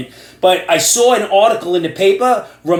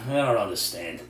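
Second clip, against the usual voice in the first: normal talking voice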